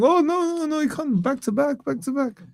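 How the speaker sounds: put-on high whiny Russian accent. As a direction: whine it in a hammy Russian accent. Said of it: "stop doing a Russian accent when you're imitating me".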